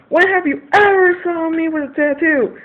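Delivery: high, loud, tearful outburst; upset dad. Retold or imitated. Dad scolding daughter about tattoos, high tearful outrage. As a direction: push it out, voice close to cracking.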